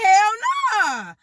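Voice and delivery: high, drawn-out mock refusal; squealed comic objection; cousin's high refusal. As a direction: imitate a cousin balking, way up high.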